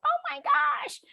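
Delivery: high-pitched mock panic, short gasped exclamation; mock freak-out. Teacher mimicking her own freak-out for the class, mock panicked. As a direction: squeal it like a teasing self-parody of panic. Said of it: "if I'm freaking out".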